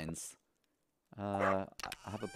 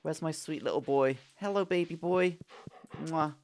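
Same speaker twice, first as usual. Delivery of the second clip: higher-pitched sweet cooing; affectionate pet greeting; 6 semitones higher than usual. Real, not acted.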